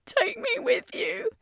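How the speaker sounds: high, slow tearful whisper; young girl pleading through tears. A narrator's reading. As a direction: beg in a breathless whisper, as if crying. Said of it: "Ashley said through the tears".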